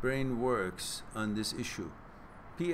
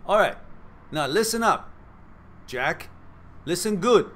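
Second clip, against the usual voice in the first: loud, much higher mock drill-sergeant command; tough love; 5 semitones higher, 8 dB louder than usual. Streamer scolds a scared-flyer viewer, loud tough-love command. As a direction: bark it like a coach, but playful. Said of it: "And I mean it with love".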